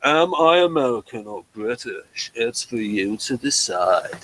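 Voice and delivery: put-on slow, drawn-out half-American, half-English accent; Lloyd Grossman, transatlantic accent. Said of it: "Lloyd Grossman is like".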